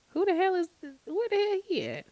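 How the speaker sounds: who could this be, high and shocked; outraged surprise. Real, not acted. Vlogger startled by a text, high incredulous squeal.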